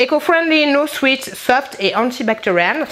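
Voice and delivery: hamming up the American YouTuber English; American accent. Real, not acted. Vlogger repeats the label in a hammed-up American accent. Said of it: "parler comme une américaine".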